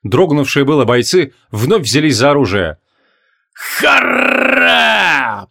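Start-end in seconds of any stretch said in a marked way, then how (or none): 3.5-5.5 high roaring war cry; roaring battle cry. A narrator's reading. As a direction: roar like a charging warrior. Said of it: "прорычал барон Джориан".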